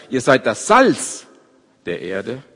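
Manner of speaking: "Salz" slow and weighted, "der Erde" falls away; stress on SALZ. Shown as a demonstration. Preacher tests stress on the later part, weighting "Salz". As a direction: linger on "Salz", then drop off. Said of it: "Betonung eher auf dem hinteren Satzteil".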